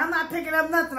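lightly voiced stubborn refusal; retold refusal.

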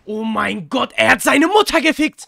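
high, loud hype; excited shout. Real, not acted. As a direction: yell it out in disbelief. Reacting to shootout.

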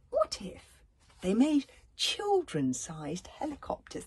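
high, wondering recital with whispered parts; poem reading. Acted. Reading a what-if poem to children: high, wondering. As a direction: read it full of wonder for children.

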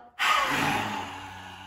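loud breathy roar with tongue out; lion's breath exhale. Shown as a demonstration. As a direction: force the breath out like a lion. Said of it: "Let's do three together".

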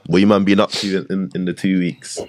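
normal talking voice

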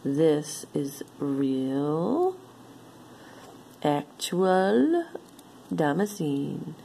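drawn way out, savoring; slow emphatic. Real, not acted. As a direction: stretch every word.

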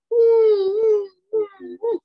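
loud, high hooting ooh; piercingly high imitated whistle blast; train whistle. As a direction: hoot like a steam whistle. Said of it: "Now the whistle blows".

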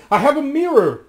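very high, loud cry; calling out. A narrator's reading. Anne calls after the reflection, high and loud. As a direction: cry out to be heard far away. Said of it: "cried Anne".

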